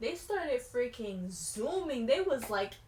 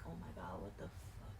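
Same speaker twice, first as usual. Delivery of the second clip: scared whisper; 4 semitones lower, 12 dB quieter than usual. Retold or imitated. Scared in the truck.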